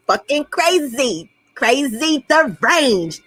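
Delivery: drawn-out, slow mocking words. Real, not acted. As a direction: stretch each word out to mock him. Mocking his unraveling.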